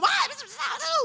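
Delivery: high loud hammed-up shout; mock shouting. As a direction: explode right away, over the top. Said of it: "belum apa-apa, udah". (teriakan marah pura-pura, tinggi)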